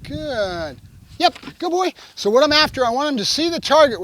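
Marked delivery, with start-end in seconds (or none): 0.0-0.8 GOOD stretched long and falling; drawn-out duration marker. Real, not acted. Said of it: "Duration marker". 1.2-1.9 quick, high release and praise; excited dog praise. Real, not acted. Praising dog after release marker.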